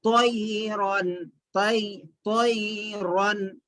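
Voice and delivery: तोयरन slowly with a thick, rounded t; heavy t, correct. Shown as a demonstration. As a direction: say तोयरन full-mouthed, as "toy-ron". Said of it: "तो मोटा पढ़ना".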